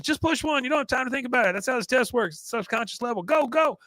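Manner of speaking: high-pitched, pressing mock urgency; imitated excitable hurrying; frantic urging. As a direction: push him to answer right now. Voicing Roger hurrying Hank.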